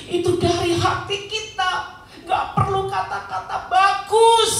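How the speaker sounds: high, insistent sermon cry; fervent preaching. Real, not acted. Preacher drives the point home. (seruan khotbah yang penuh semangat)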